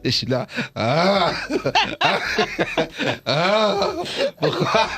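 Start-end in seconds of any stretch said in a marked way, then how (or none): 0.8-3.9 high drawn-out "ah" of panic; re-enacted whimpering cry; mock wail. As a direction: wail in exaggerated dread. Acts out her panic at needle.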